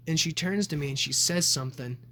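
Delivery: normal talking voice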